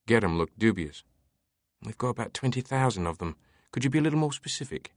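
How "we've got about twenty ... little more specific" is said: lightly voiced amused librarian.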